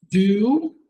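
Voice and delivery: ordinary talk, nothing marked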